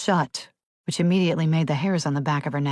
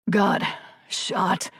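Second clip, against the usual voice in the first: faint, fading wounded man; lightly voiced dying janitor; weak, wounded; 3 semitones higher than usual. A narrator's reading. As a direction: push out words through the pain. Wounded man barely speaking.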